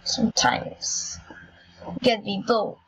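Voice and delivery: slow, low mock German accent. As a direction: put on a bit of a German accent, drawn out. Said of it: "J'ai fait un peu l'accent allemand". (petit accent allemand, lent)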